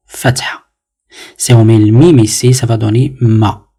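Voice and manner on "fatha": Fatha whispered under the breath. Shown as a demonstration. Teacher whispers Fatha after naming the vowel. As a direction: breathe Fatha softly as an echo. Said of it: "il s'appelle fatha".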